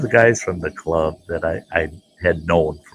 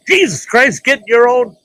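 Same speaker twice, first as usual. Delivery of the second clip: much higher and louder panicked yell; 7 dB louder than usual. Retold or imitated. Driver swerving from a head-on crash, high panicked yell. Near head-on collision on highway.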